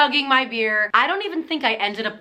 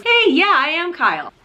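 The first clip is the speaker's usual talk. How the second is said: higher, dopey guy impression; mock Kyle voice; 5 semitones higher than usual. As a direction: play the clueless date, pitched up. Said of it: "and he just goes".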